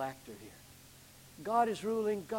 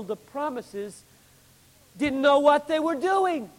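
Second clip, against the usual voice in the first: loud, raised preaching voice; full-force preaching; 9 semitones higher, 11 dB louder than usual. Acted. Preacher driving the point home to his congregation, loud and fervent. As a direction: pound the point home from the pulpit.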